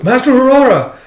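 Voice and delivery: high, loud shout after someone; urgent calling out. Acted. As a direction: call out to stop someone leaving. Akane calling after departing master.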